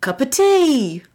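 slow, high, delighted call; excited exclamation. Retold or imitated. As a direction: sing out a happy discovery. Imagining her first free day.

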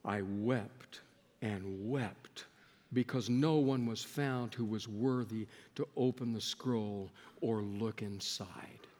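a subdued, sorrowful scripture read; hushed reading. Acted. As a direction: read the weeping quietly, grave. Reading John's weeping hushed.

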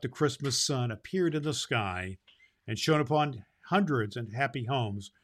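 plain reading voice

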